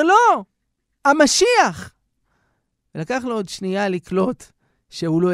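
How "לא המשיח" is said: high, loud insistence; old rabbi telling the groom he meant the Messiah, fervent; fervent correction. Acted. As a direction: cry out the one you truly await.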